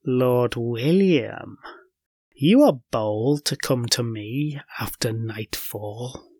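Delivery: slow, low, whispery witch voice; witch close to the ear. A narrator's reading. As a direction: menace him softly, drawing out the words. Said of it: "a voice from right beside his ear".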